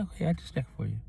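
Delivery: soft, mild retold reply; calm, not yelling. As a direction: keep it soft and unangered for the customer. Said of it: "I didn't even yell, I didn't get angry".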